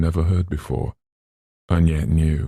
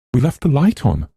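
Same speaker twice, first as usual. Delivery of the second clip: high, tense and louder; frightened; 9 semitones higher, 6 dB louder, faster than usual. Acted. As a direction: hold back the panic, pitched up. Eleanor terrified in darkness.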